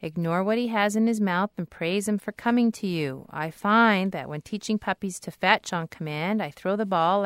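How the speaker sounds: usual speaking voice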